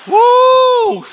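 excited whoop; sudden high cheer; high loud woo. Real, not acted. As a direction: whoop with joy. Preacher cheering.

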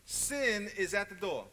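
loud, high and slow; slow, loud preacher warning; emphatic warning. Real, not acted. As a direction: drive the warning home. Preacher hammers the key line.

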